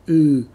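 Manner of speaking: "Ooh" said with spread smiling lips. Shown as a demonstration. Teacher demonstrating the smiling ooh vowel. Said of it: "that's the sound we're trying to get".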